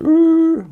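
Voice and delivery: sudden very high, loud "ouh"; hammed-up falsetto cry; high mock whoop. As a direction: imitate a metal singer's falsetto wail. Imitated noise of Georgian metal act.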